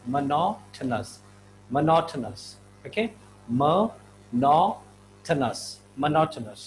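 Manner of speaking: monotonous repeated as mo-NO-to-nous; careful pronunciation. Shown as a demonstration. Teacher drilling monotonous for the class. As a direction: drill monotonous slowly, stress on the second syllable. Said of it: "It's not monotonous".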